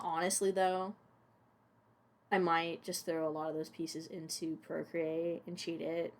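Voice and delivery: usual speaking voice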